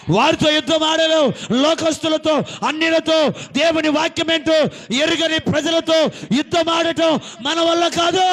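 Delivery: loud, high sermon cry; preaching at full force. Acted. Preacher shouting to the congregation, loud and high. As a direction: thunder it from the pulpit.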